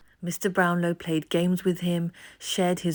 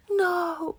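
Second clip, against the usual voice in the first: put-on boy shouting; 13 semitones higher than usual. A narrator's reading. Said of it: "Oliver shouted".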